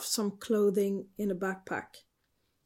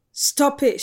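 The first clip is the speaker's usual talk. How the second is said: loud, higher command; sharp demand; 5 semitones higher, 8 dB louder than usual. A narrator's reading. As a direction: demand it with a sudden raised voice. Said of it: "she demanded".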